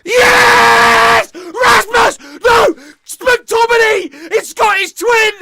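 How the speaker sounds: screaming high and loud at a goal; ecstatic goal scream. Real, not acted. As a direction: lose it completely as the goal goes in. Goal scored while watching live.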